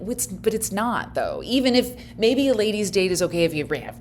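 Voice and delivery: ordinary talk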